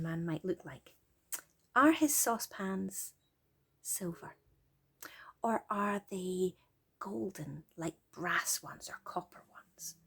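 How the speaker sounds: soft, hushed wind-down; soothing bedtime voice. Real, not acted. Parent settling a child to sleep, soft and soothing. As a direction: lull them toward sleep.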